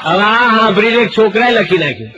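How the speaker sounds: normal talking voice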